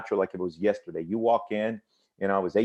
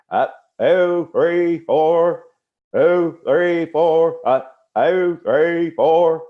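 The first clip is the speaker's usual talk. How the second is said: loud, high, chanted march count; drill instructor cadence call; 7 semitones higher, 8 dB louder, much slower than usual. Retold or imitated. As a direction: bark out the count like a drill sergeant on the parade ground. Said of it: "And he says".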